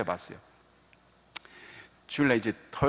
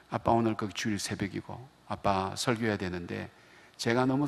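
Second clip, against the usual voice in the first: soft, settled voice of a sorry daughter; calm apology; 2 semitones lower, 6 dB quieter than usual. Retold or imitated. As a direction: apologize quietly, voice calmed down. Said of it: "목소리 차분하게 가라앉아서". (사과하는 딸, 차분하게 가라앉은 목소리로)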